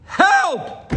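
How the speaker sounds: very high shrieked "Help!"; Reb Chaim's cry for help. Retold or imitated. Rabbi imitating Reb Chaim crying out to Hashem, high shriek. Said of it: "he goes".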